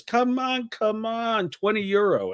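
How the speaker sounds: coaxing come-on in a put-on high voice; high mock sales pitch from a woman touting on the street; soliciting woman.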